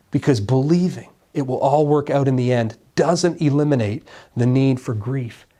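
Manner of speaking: ordinary delivery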